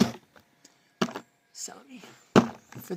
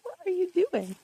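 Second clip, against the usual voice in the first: high pet voice; 8 semitones higher, faster than usual. Real, not acted. Talking to the dog.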